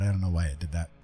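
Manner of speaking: normal talking voice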